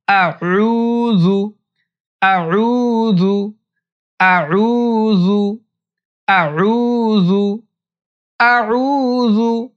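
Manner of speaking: আউযু stretched long and slow, each syllable clear; slow drawn-out Auzu. Shown as a demonstration. Teacher modelling আউযু slowly for students. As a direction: repeat আউযু slowly, vowels held. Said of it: "এতটুকু".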